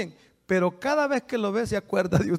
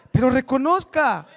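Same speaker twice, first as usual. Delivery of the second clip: normal talking voice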